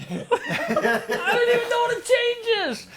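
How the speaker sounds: squeaky, slow, laughing through the words; mock bewilderment, pitched very high; laughing disbelief. Confused newbie, laughing.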